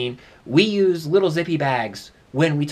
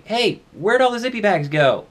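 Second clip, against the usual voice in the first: raised voice carried to the next room; calling out; 9 semitones higher, 5 dB louder than usual. Acted. Kitchen to roommate in the living room, calling out. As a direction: call the question across the apartment, loud and high. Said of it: "You yell out to your roommate".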